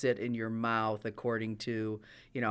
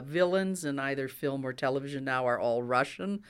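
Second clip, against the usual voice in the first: ordinary delivery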